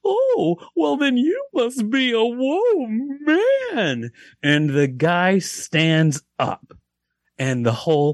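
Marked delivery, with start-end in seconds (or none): 0.1-4.1 very high, drawn-out clown punchline. Acted. Clown mocking the front-row man, high and slow. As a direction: stretch it out in a silly high clown voice. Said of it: "the clown's like".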